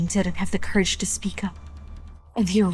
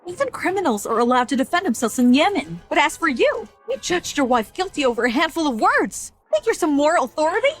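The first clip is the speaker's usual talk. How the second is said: heated higher-pitched lecture; indignant rebuke; 5 semitones higher than usual. Acted. Mother to the husband who judged his wife, indignant rebuke. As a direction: take him to task, voice rising high.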